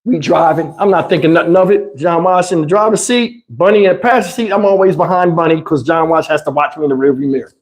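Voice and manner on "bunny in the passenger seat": ordinary delivery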